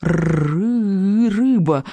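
stuttering dragon squeal with a drawn-out first sound; squealing little dragon. A narrator's reading. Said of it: "пищал Беззубик".

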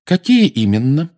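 high, loud, pointedly polite question; polite challenge. A narrator's reading. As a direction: ask politely, ready for a fight. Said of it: "вежливо спросил Сириус с видом человека, готовящегося к бою". (вежливо, но с вызовом)

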